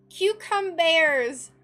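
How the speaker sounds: "cucumbers!" called out loud and drawn out slowly; loud exclamation. Real, not acted. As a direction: shout "cucumbers!" long and slow, fed up with the glut.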